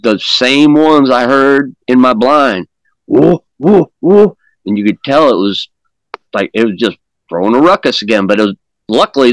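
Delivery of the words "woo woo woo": rising, louder mock hoots; imitated gorilla hoots. Describing the gorilla sounds from the cave, imitated hoots. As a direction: hoot it like an ape across the river. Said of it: "I hear the gorilla sounds".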